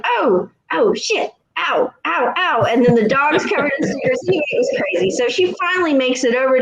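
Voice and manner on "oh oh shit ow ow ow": high little ow ows; mock pained yelps. Neighbor stepping on stickers, mock pained yelps. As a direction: yelp at the stickers. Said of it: "'Cause she was like".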